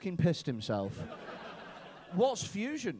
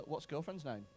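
ordinary talk, nothing marked

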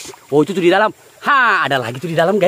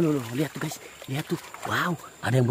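much softer, almost under the breath; hushed; 3 semitones lower, 12 dB quieter than usual. Real, not acted. Vlogger quietly showing viewers a fish hiding: hushed, much softer. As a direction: point it out very softly so nothing stirs.

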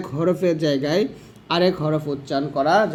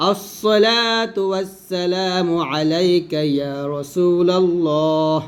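slow chanted salutation; measured religious recitation; devotional recitation; much slower than usual. Shown as a demonstration. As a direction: draw out each word slowly. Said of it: "তারপর দেখুন".

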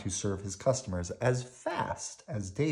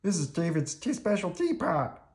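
high, upset puppet voice; distressed high explanation; Bert; 9 semitones higher than usual. A narrator's reading. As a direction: confess miserably, pitched up. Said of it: "he said".

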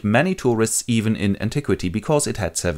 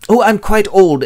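high, very loud announcement; eager messenger; 12 semitones higher, 9 dB louder than usual. Acted. Messenger announcing himself, high and very loud. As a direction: proclaim yourself.

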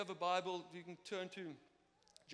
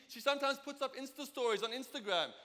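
ordinary talk, nothing marked

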